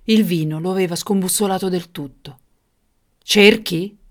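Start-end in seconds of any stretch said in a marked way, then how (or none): 3.2-4.0 loud, incredulous angry outburst; furious tyrant's exclamation. Acted. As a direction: throw it back in fury. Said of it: "esclamò il furioso il tiranno".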